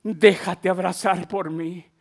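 low, soft, gentle plea; tender. Acted. Preacher voicing the Holy Spirit's invitation, tender. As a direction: let the invitation fall softly. Same divine words, now tender.

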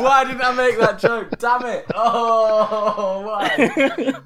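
high, loud laughing wail; mock anguish. Real, not acted. Contestant regretting a missed joke, high loud laughing lament. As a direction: cry out in comic regret through laughter.

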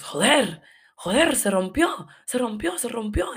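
high, frantic repeated cries in Spanish; panicked. Retold or imitated. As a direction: blurt it out in a panic over the broken condom. Panicking boyfriend, condom broke.

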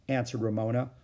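ordinary delivery, nothing marked

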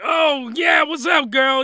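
loud, high, cocky greeting; hammed-up excited come-on; eager come-on. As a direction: holler a flirty hello. Voicing man greeting the maid.

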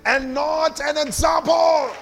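rising to a shout on the last words, slow and weighty; preaching at full force. Acted. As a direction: proclaim it like a preacher building to his peak. Preacher driving home point.

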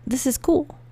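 high, upbeat retold approval; happy enthusiasm. Dad down for anything new, happily approving. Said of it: "Like, in a happy way".

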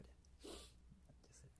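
なんじゃそれ said again in a soft unvoiced whisper; half-amused whispered aside; whispered repeat. Real, not acted. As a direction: mutter it to yourself under your breath. Retort repeated under breath.

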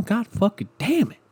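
high, strained angry ranting about weekends; worked-up rant. Real, not acted. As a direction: vent it like you're fed up and boiling over. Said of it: "I'm so worked up over here".

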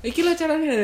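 high sing-song demo line; high mock quote. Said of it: "sama arek".

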